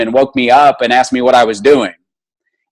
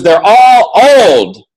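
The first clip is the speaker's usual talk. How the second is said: high, loud, very slow emphatic; pointed nurse; 8 semitones higher, 5 dB louder, much slower than usual. Retold or imitated. Nurse telling patient the others are old, slow and loud. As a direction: drive the point home slowly.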